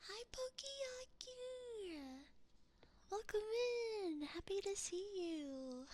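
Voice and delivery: high, bright welcome to a new viewer; excited greeting. Real, not acted. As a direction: call out happily to someone arriving. Greeting viewers joining stream.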